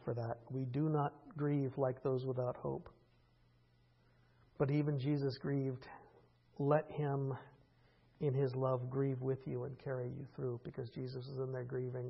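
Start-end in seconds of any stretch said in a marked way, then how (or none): none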